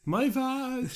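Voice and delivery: very high-pitched mock wail; imitated scream. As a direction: drag out a hammed-up cry of pain. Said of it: "she just starts screaming".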